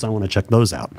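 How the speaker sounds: normal talking voice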